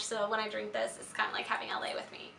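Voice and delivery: soft, slightly raspy voice; a little raspy. Real, not acted. Vlogger musing on a favorite mug, a little raspy. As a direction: let it drop soft, with a light rasp. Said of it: "my voice is a little raspy".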